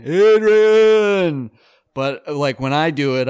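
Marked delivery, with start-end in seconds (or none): 0.0-1.5 loud Rocky-style yell; comic imitating a famous movie shout; mock shout. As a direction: bellow the name like a boxer. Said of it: "it wouldn't be like shouting".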